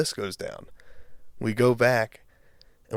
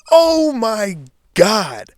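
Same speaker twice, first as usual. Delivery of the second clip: higher, louder, slow drawn-out disbelief; stunned realization; 11 semitones higher, 8 dB louder, much slower than usual. Retold or imitated. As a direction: stretch it out like you can't believe your eyes. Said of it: "with the fucking bottle in his hand".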